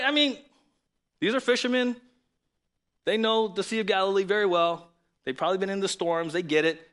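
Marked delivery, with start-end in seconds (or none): none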